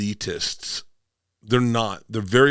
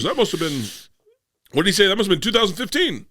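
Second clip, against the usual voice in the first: imitating dad; 9 semitones higher, faster than usual. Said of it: "he goes".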